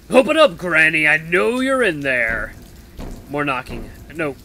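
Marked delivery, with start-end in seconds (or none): 0.1-2.5 loud, drawn-out yell through a door; slow, threatening holler; menacing shout. Acted. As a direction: bellow for someone to open up. Intruder yells through the door.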